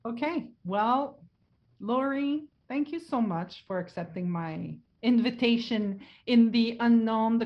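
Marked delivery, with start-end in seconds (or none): none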